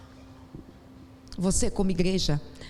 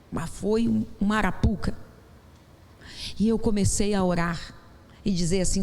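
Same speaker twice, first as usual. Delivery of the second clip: normal talking voice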